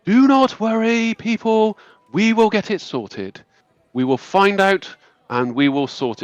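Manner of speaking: high, measured voice of a graceful elf leader; calm high elf lady. Acted. Elf lady calming the angry townsfolk, high and measured. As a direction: soothe the crowd with calm authority. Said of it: "this high elf lady addressing the crowd going".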